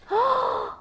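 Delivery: high, delighted gasp; big gasp. Acted. Reader opening the danger box for the child, thrilled gasp. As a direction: gasp like the box just opened. Peeking under the flap.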